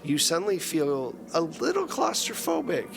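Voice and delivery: ordinary talk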